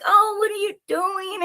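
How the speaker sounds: high, drawn-out mimicry of the ex; mock casual ex. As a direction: draw it out high, imitating him. Retold phone call from ex.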